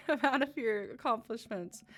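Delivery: words broken up by real laughter; giggling and getting choked up at once; laughing through tears. Real, not acted. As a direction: laugh while pushing the line out. Player breaks, laughing.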